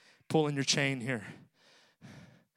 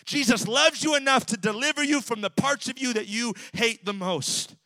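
high, loud, shouting sermon delivery; full-force preaching; 11 semitones higher, 5 dB louder than usual. Acted.

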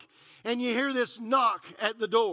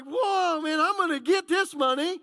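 excited whoa; 5 semitones higher than usual. Retold or imitated. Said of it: "I'm like".

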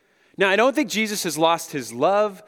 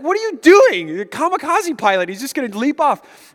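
much higher and louder startled cry; alarmed parent; 9 semitones higher, 7 dB louder than usual. Retold or imitated. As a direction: shout at a toddler about to jump. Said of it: "We're like".

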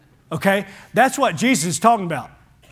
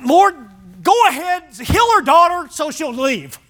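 high, exasperated brush-off to the Lord; mock-annoyed disciples begging; impatient plea; 6 semitones higher than usual. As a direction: toss it off like you want her gone.

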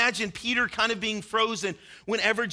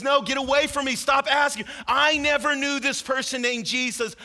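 louder and higher, snapping; angry denial; 5 semitones higher, 4 dB louder than usual. Acted. Peter cursing at his accusers in the courtyard, louder and higher. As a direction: shove the accuser away with your voice. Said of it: "He even starts cursing and says".

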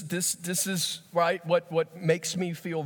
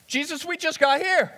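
higher, exasperated complaint; protesting Peter; 13 semitones higher, 4 dB louder than usual. Acted. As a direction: whine that they only just arrived. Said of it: "Peter protesting Jesus's plan".

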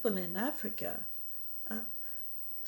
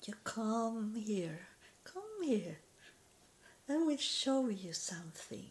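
devil's sweet luring voice. Retold or imitated. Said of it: "This how he is sounding".

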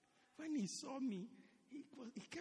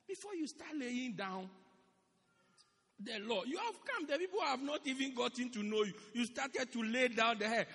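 ordinary delivery

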